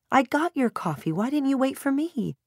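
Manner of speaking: high-pitched, fretful reproach; dismayed mom questioning her daughter; exasperated mother. A narrator's reading. Mother scolds impatient daughter.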